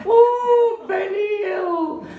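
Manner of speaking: very high, drawn-out mocking whoop; cooing women customers. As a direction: imitate a tableful of women hooting. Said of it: "they're all going".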